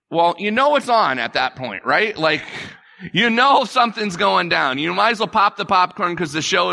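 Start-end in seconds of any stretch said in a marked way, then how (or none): none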